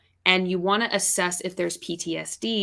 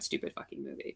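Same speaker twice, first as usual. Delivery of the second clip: whispered; 15 dB quieter than usual. Real, not acted. Disgust at the movie.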